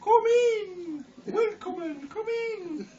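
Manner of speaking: high, loud, hammed-up German accent; a wolf playing at grandma, in a squeaky German voice. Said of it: "He was German also".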